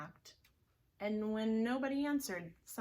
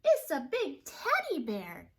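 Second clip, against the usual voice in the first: put-on higher, louder baby delight; a cub spotting the teddy; high loud baby bear; 7 semitones higher, 9 dB louder than usual. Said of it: "said Baby Ba".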